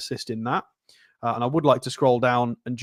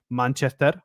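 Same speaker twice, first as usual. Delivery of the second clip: "Manchester" with a Spanish accent; Spanish-accented city name. Shown as a demonstration. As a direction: put Spanish vowels and rhythm on "Manchester". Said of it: "You would have to say".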